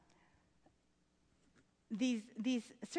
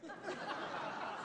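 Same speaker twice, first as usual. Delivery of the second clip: breathy laugh; 7 semitones higher, 7 dB quieter than usual. Real, not acted. Laughs after reading.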